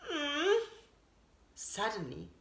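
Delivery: plain reading voice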